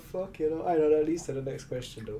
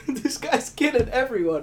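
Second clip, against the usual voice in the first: excited exclaim; 10 semitones higher, 8 dB louder than usual. Real, not acted. Laughing reaction, loud and high.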